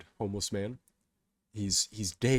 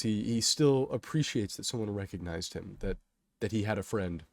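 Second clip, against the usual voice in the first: plain reading voice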